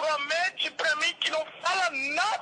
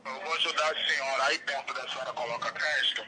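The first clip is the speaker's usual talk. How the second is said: much lower, gruff-pitched man's threat; menacing kidnapper; 13 semitones lower, 3 dB quieter, faster than usual. Acted. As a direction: play the kidnapper taking the phone. Kidnapper voice continues, faster.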